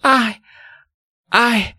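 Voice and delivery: very high, halting syllable; acted tongue-tied girl; struggling to speak. A narrator's reading. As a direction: choke on the word with long gaps. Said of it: "she tried to utter an apology".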